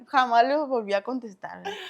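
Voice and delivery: ordinary delivery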